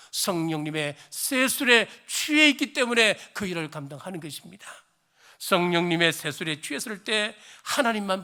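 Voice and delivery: loud, soaring sermon delivery; fervent preaching. Acted. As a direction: preach it out to the whole congregation. Sermon climax.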